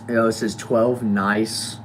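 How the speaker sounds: ordinary talk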